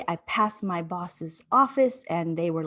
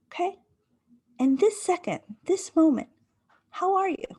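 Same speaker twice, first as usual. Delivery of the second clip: higher, gently insistent questioning of herself; self-coaching question; 11 semitones higher than usual. Retold or imitated. At the bus stop, coaching herself to calm, high and insistent. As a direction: coax yourself back to the present. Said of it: "And I said".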